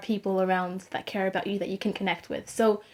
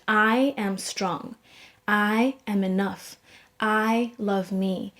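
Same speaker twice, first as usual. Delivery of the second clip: slow, deliberate I am statements; each word spaced out and firm; slow affirmation; much slower than usual. Retold or imitated. Student repeats affirmations slowly to herself.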